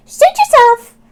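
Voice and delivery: high, loud squeaky rat greeting. Acted. Robert the rat meeting Oscar, high squeaky hello. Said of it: "said Robert".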